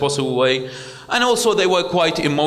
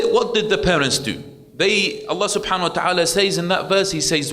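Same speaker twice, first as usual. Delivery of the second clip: usual speaking voice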